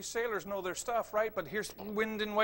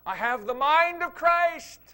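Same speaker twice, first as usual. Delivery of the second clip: loud, high, slow proclamation; bold declaration; 8 semitones higher, 12 dB louder, slower than usual. Acted. Preacher proclaiming scripture, loud and slow. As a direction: declare it with conviction.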